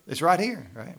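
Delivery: ordinary talk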